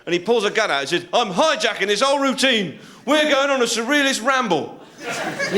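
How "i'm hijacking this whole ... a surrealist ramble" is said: high-pitched dramatic threat; hammed-up gunman's declaration; hijacker's announcement. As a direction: take over the room, pitch raised.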